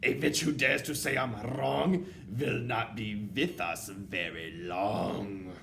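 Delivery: loud witch chant, slowing to a long last word; ominous witch sentence; terrible recital. A narrator's reading. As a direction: intone the threat, stretching out the ending. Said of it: "continued with her terrible recital".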